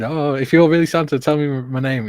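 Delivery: put-on a thrilled kid testing Santa; excited child. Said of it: "kids were, like, all excited".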